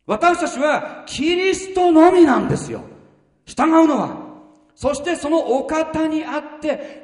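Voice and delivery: loud, high preaching build; preaching at full force. Acted. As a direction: proclaim it to the congregation. Preaching climax. (力強い説教)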